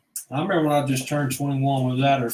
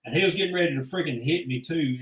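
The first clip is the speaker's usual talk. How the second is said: normal talking voice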